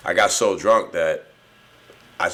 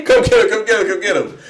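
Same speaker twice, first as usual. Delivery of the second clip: high, loud call to the others; urgent call; 13 semitones higher, 7 dB louder than usual. Retold or imitated. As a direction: yell for someone to grab him. Friends calling as he escapes.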